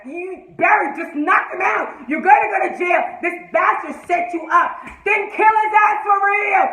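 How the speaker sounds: high, loud, urgent shouting; fired-up, exasperated shout; heated fan rant. Real, not acted. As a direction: yell orders at the hero. Rant urging hero to fight.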